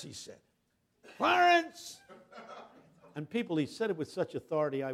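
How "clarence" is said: Clarence shouted high and loud; retold yell. Pastor yelling the dead man's name, high loud shout. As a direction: bellow the name with authority. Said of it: "He just yelled at this corpse".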